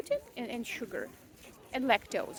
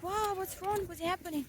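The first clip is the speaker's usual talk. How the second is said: higher-pitched concerned questioning; alarmed concern; 6 semitones higher than usual. Retold or imitated. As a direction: imitate a startled, worried doctor. Doctor reacts to her sudden sobbing.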